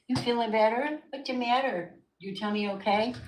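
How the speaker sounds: high, sing-song cooing. Real, not acted. Calling her cat over to comfort her, high baby talk. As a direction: coax the kitty over gently.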